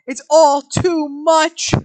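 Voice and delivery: very loud, slow overwhelmed; loud slow emphasis. A narrator's reading. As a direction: let it crash down. Read with sudden force.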